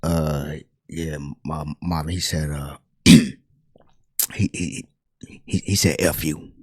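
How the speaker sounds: low, very soft, stammering voice; reluctant confession. Retold or imitated. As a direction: haltingly admit it, barely audible. Singer reluctantly reports insult to Marvin.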